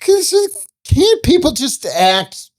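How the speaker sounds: high, complaining actor voice; mocking a pretentious actor, whining high; whiny mock rant. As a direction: whine it like a fed-up actor.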